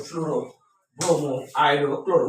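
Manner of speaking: teacher modelling ফ্লুরো, ব্রোমো, আয়োডো, ক্লোরো one by one; pronunciation demo. Shown as a demonstration. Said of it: "উচ্চারণটা এরকম হবে".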